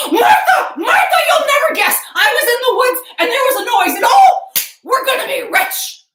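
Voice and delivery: high, loud, breathless rush of news; overjoyed husband yelling; bursting with excitement. Acted. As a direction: burst in shouting the good news.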